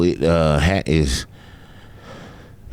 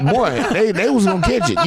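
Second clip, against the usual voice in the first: normal talking voice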